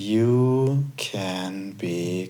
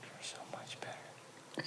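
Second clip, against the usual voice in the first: faint whisper; whispered echo; 20 dB quieter than usual. Shown as a demonstration. Co-host echoing, faint whisper. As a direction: barely breathe it. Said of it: "you can just repeat".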